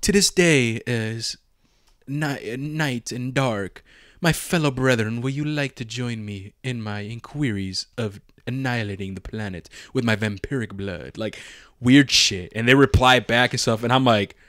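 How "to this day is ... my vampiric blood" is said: mock vampire role-player. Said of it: "these motherfuckers be speaking like".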